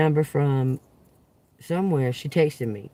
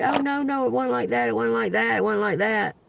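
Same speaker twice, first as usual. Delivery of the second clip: mimicking her denial; 6 semitones higher than usual. Retelling her nervous denial.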